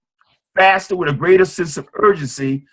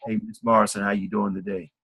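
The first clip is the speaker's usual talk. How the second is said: much lower and much softer greeting; timid soft-spoken hello; solemn low energy; 8 semitones lower, 9 dB quieter than usual. Shown as a demonstration. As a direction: greet her like a quiet solemn mouse. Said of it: "nice little solemn people".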